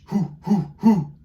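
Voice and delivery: high, loud owl hoots; breathy owl hoo from the diaphragm. Shown as a demonstration. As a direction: hoot like Papa Owl on the breath. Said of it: "diaphragm warm up".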